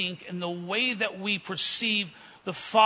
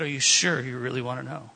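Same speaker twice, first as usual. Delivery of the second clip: teasing bait; 7 semitones lower than usual. Retold or imitated. Preacher baiting the curious waiter, teasing and lower. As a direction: dangle the question to tease him. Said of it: "kind of baiting him a little bit, having fun".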